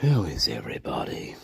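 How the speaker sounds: breathy whispered greeting. Acted. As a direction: whisper it like a secret.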